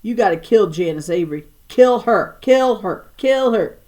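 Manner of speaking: loud, rising chant of kill her; mock battle cry, each kill punched; egged-on yelling. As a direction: whip the kid into a frenzy. Egging on the furious child.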